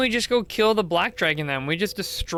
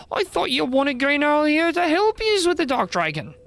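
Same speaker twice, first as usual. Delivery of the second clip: high-pitched, indignant character voice; scolding slangy busybody; 11 semitones higher, 4 dB louder than usual. Acted. Newcomer breaks up a fight, high and scolding. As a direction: scold them like squabbling nippers, pitched way up.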